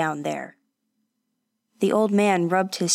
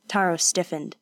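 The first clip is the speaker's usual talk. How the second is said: usual speaking voice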